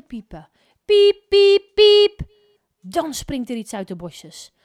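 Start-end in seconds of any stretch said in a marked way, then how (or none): 0.8-2.2 very loud, high beeps; loud beeping. A narrator's reading. Detector beeping louder and louder, very loud high beeps. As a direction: blare like a detector gone wild.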